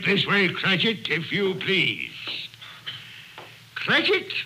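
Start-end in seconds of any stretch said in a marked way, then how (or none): 3.7-4.3 sharp high bark of the name; feigned anger, a sharp call; mock stern call. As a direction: snap his name out in pretend anger. Scrooge feigns anger at clerk.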